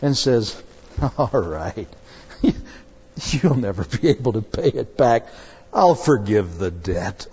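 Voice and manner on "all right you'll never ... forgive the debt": softer, lower forgiving voice with a chuckle; compassionate. Retold or imitated. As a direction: forgive him gently, low. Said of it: "has compassion upon him".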